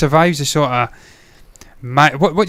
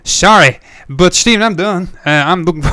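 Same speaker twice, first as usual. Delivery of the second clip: raised, hammed-up American accent; put-on American accent; 2 semitones higher, 4 dB louder than usual. Host apologising to Stephen, hammed-up American accent. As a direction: push the mock American accent, close to cracking up. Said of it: "I'm just putting this on".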